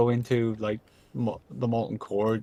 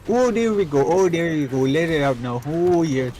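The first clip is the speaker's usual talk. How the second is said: louder, higher hammed-up Canadian; terrible Canadian accent; 3 semitones higher, 6 dB louder than usual. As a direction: cheer on the victim in fake Canadian. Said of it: "I do a terrible Canadian accent".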